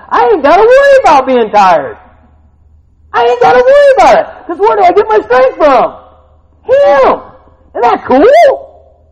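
high, loud, rousing preaching; fiery preaching. Acted. Preacher rallying the congregation, full-force preaching. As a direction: fire up the congregation.

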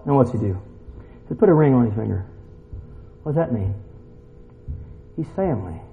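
ordinary talk

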